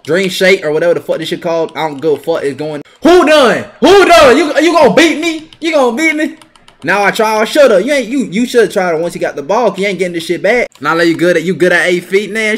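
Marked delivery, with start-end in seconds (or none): 3.0-6.4 high, loud mocking challenge; loud taunt. Real, not acted. Gamer taunting a beaten opponent, loud challenge. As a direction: rub it in his face.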